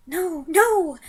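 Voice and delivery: high, loud begging; begging witch. Acted. Front-row witch begging for mercy. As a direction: plead frantically. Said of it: "begged the witch".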